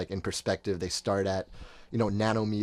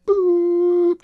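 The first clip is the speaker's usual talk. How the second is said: high, loud cartoon boop sound; squeaky shut-down beep; brain shutting off "boop"; 12 dB louder than usual. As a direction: make a sudden high blip like a brain switching off. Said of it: "my brain just went again".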